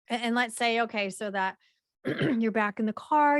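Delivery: usual speaking voice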